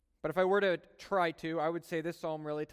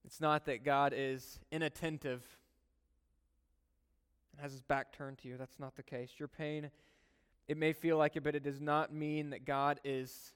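softer, gentle reassurance; soft and loving; 2 semitones lower, 6 dB quieter than usual. Real, not acted. Pastor comforting a hurting listener softly. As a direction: speak tenderly to the hurting. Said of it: "speak to you very softly and in love".

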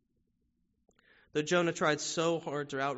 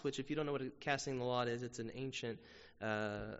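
usual speaking voice

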